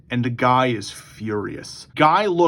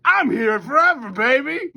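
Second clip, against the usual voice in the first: high-pitched, crowing character voice; hammed-up, high exultant cry; Lobo; 10 semitones higher than usual. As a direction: crow it high, stretched out in triumph. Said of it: "Lobo looks at him and goes".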